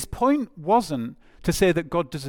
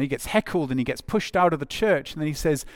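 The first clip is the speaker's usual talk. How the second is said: ordinary talk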